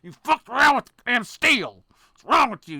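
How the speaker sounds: riled-up tradesman scolding bad welds; Weldon fed up. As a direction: scold them like an offended welder. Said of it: "That's what pisses Weldon off".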